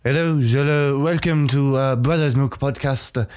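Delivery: put-on welcoming host in a wandering French accent; hammed-up French accent with lingering hesitations; muddled French-English accent. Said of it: "what accent that was turning into. It was French".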